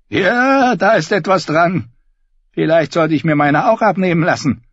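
high, loud bleating sheep voice. Acted. Davin voiced by narrator.

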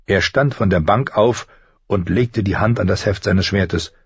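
usual speaking voice

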